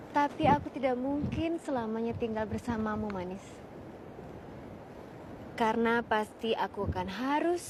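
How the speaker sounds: tender recital. Shown as a demonstration. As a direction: say it gently to Dea. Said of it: "Dengar nggak?". (deklamasi lembut penuh kasih)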